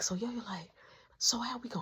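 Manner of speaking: soft unvoiced whisper. Friend whispering while sneaking home. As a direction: keep it hushed, sneaking.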